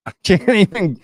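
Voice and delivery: high, loud, breaking into laughter; laughing. Real, not acted. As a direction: lose it mid-sentence.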